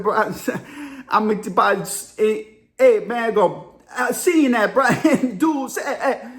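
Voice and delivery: garbled country dude, laughing sounds between words; hard-to-understand country speech, played for laughs; Sammy. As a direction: mumble half-words in stuttering bursts. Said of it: "and he spoke like this".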